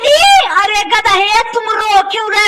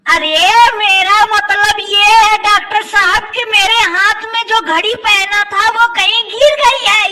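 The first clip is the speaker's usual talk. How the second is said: ordinary delivery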